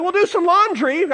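high, loud defensive retort. Retold or imitated. Husband answering his wife about laundry, defensive. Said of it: "I'm getting a little defensive".

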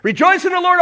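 high, loud preacher's cry; exultant exhortation. Acted. Re-enacting Paul's letter.

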